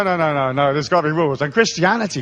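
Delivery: normal talking voice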